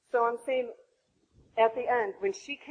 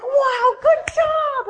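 very high, loud imitated praise; happy cheering; 14 semitones higher, 12 dB louder, much slower than usual. Doula cheering the mother's loud noises, overjoyed. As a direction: cheer her on with squealing delight. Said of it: "she got so happy every time".

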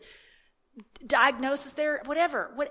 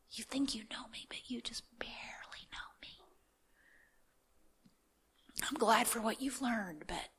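calm whisper; 10 dB quieter than usual. Retold or imitated. God calming her anxious prayer in a tender whisper. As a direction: soothe her like a loving parent. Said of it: "his voice is just, just calm".

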